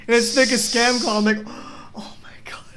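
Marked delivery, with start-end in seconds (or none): 1.4-1.9 exaggerated sharp gasp; mock shocked gasp. Acted shock at scam call. 2.0-2.8 hushed, pretend disbelief; mock shocked whisper. Acted shock at scam call.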